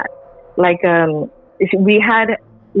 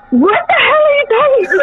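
very high-pitched mock scream; high, exasperated outburst without full volume; retold screaming; 3 dB louder, faster than usual. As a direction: act out yelling in freaked-out disbelief. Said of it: "Like, I'm screaming".

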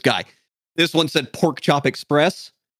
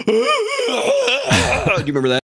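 much higher, slow, shouted mock squeal; imitating Katie; much slower than usual. Imitating how his friend Katie sounds, high mock squeal. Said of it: "That's what Katie sounds like".

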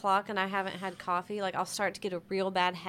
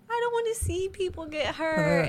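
put-on higher whiny child voice; daughter voice; 10 semitones higher, 3 dB louder than usual. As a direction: whine it like a young girl. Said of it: "She's like".

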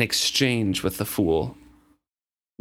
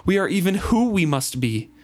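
raised, elated prophetic voice; exultant; 8 semitones higher than usual. Acted. Reader voicing the fool, newly certain of his prophecy, exultant. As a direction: proclaim it with joy. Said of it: "spun away".

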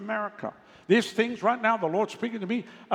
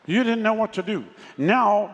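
ordinary talk, nothing marked

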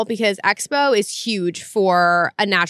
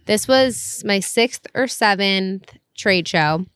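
usual speaking voice

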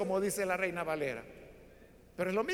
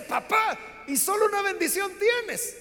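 high, loud tearful pleading; a son still weeping, begging his father; crying son; 9 semitones higher, 4 dB louder than usual. A narrator's reading. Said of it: "Esaú sigue llorando".

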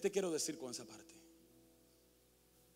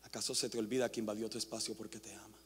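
low, much softer, intimate appeal; soft altar call; 5 semitones lower, 4 dB quieter than usual. Acted. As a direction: speak quietly to one heart at a time.

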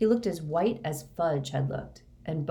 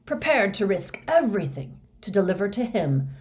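fanatical fervor; 5 dB louder than usual. Acted. Moody boasts he alone stayed faithful, fanatical.

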